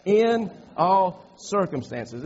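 slow, spaced words with "in" punched; stress on IN. Shown as a demonstration. Preacher has the congregation repeat it, stressing "in". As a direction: hit "in" hard, then measure out the rest. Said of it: "the emphasis is in".

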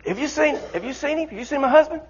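high, anxious asking; wife asking others; worried wife asking. Acted. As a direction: plead for news.

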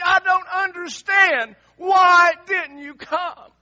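loud, high, crying out in grief to the Lord; a grieving sister's cry, slow and broken; anguished pleading. Acted. Martha crying out to the Lord, loud anguished grief.

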